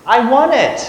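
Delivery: put-on high, loud little-kid demand; demanding small child. Niece demanding a treat from mom, high loud childish whine. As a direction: imitate a bratty preschooler insisting at full volume.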